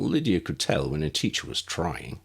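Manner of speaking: ordinary delivery, nothing marked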